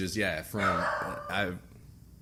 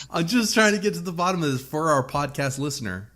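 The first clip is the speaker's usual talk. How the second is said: ordinary talk, nothing marked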